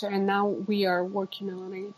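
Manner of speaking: normal talking voice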